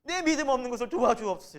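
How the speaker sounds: loud, high, clinging cry of faith; desperate plea. Acted. Father crying out to Jesus for his son, desperate and loud. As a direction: beg with all your strength. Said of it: "매달리는 거예요".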